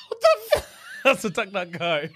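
laughing through the joke; cracking up at own joke. Real, not acted.

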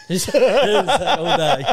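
words breaking up with laughter; loud high laugh through the question. Real, not acted. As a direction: crack up while asking, really laughing. Cracks up repeating co-host's line.

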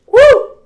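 loud, high-pitched woo; excited whoop. Real, not acted. Cheering listeners on at sign-off, excited whoop. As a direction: let out a piercing cheer.

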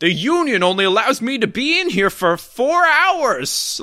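high, swooping mock announcer voice; hammed-up voice actor on the clock; bad narrator. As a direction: complain in an overblown narrator voice. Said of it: "doing a terrible impression of the narrator".

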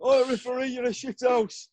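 very high, mocking heckle at the referee; heckling fan. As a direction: jeer at the ref like a terrace regular. Mimicking a fan abusing referee.

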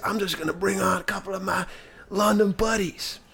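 mock stage announcer. Rapper bringing out London buddies, mock hype announcement. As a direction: imitate a performer hyping up the crowd.

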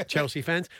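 ordinary delivery

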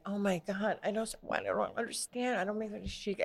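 small, high, quiet voice of bewilderment; lightly acted helpless dismay; mock bewildered panic. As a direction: imitate yourself flustered, high and hushed. Acting own panic beside cool friend.

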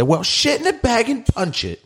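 high-pitched mock British exclamation; imitating British friend. Retelling a reunion with British friend, high mock British accent. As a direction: throw it out high as a hammed-up British greeting. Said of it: "I used to have this British friend".

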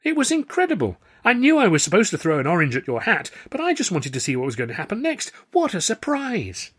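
high, loud, gushing excitement; thrilled amazement. A narrator's reading. Fellow comic praising the dove trick, thrilled. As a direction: gush with delight, pitched right up.

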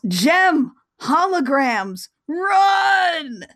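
high, drawn-out warning shout; hammed-up yell. Host voicing Corbin warning the band, hammed-up yell. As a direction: call out each word long and urgent. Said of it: "and then yells".

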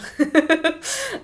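high rueful laugh; a short rueful laugh. Real, not acted. Laughing that asking locals failed, high rueful laugh.

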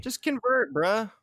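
mock bro. Joking to a friend about a martyr, casual bro tone. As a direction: toss it off like a bro.

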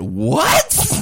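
high, loud stunned what; high loud disbelief; shocked what. Retold or imitated. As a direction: gasp it out in disbelief. Retold shocked reaction to dad.

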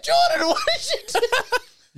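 high, slow words through laughter; laughing disbelief. Real, not acted. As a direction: gasp it out while laughing. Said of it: "I was like".

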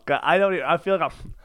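usual speaking voice

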